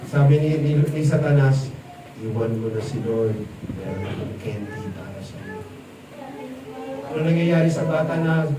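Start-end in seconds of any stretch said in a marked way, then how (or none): 2.2-5.6 low, hushed luring voice; deep sly devil whispering; sly tempter. Acted. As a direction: coax the child like a devil. Satan tempting a child.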